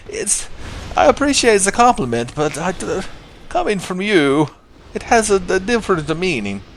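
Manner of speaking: high, hesitant character voice; Miguel. Acted. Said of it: "And Miguel's like".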